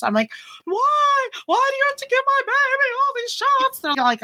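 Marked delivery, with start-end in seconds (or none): 0.7-3.8 very high, wailed objection; high-pitched mock dismay; retold protest. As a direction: act out an indignant mother protesting in a high squeal. Acting out protest at nurses.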